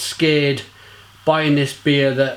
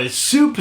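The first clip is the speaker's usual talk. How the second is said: "super" pitched much higher; stress on super; 9 semitones higher than usual. Real, not acted. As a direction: jump up on "super". Stressing how sour.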